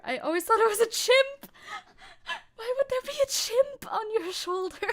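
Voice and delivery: high, loud disbelief; surprised. Real, not acted. Chimp instead of chip realization.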